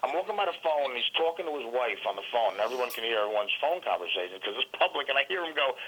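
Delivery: ordinary talk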